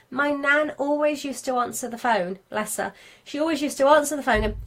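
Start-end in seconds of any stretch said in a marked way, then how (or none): none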